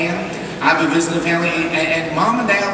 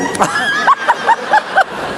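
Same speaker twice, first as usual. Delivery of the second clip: big shrieking laugh. Retold or imitated. Cousin on the floor laughing.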